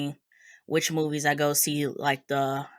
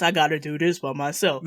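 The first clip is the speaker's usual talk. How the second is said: serious hero voice. Retold or imitated. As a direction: play it dead serious, like a lone hero. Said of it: "him being all serious".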